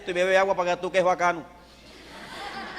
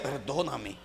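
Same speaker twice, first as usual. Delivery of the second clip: much softer, lower, humble asking forgiveness; inner plea; 7 semitones lower, 11 dB quieter than usual. Retold or imitated. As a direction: murmur it inwardly, ashamed. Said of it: "mi mente le decía".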